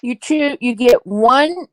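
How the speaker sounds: ordinary delivery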